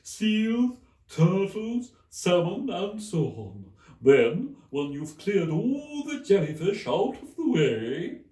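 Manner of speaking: high, loud, mournful Mock Turtle. A narrator's reading. The Mock Turtle quizzes Alice, high and mournful. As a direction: stretch it out like a weepy old turtle.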